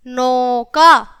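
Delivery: very slow, each sound stretched; drawn-out example word. Shown as a demonstration. Teacher says example word for class. (शब्द को खींचकर धीरे बोलना)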